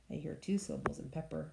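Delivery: usual speaking voice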